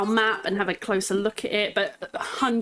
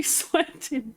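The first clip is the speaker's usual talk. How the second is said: ordinary delivery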